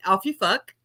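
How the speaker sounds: light quoted catchphrase; quoting Wee Frosty. Retold or imitated. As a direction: toss off the catchphrase. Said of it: "In the words of, uh, Wee Frosty".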